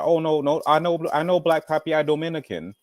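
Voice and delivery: ordinary talk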